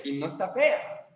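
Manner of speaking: ordinary delivery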